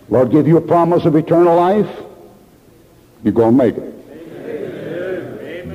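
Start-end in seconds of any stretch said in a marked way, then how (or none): 3.2-5.7 tender reassurance. Real, not acted. Preacher reassures congregation.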